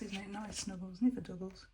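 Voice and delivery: ordinary delivery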